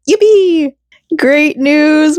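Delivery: a bright little victory yelp; gleeful cheer. Real, not acted. Vlogger cheering the good news to viewers, excited squeal. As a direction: squeal it out high and delighted.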